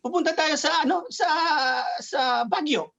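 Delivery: lightly acted movie line in Tagalog; acted movie character. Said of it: "somebody would say".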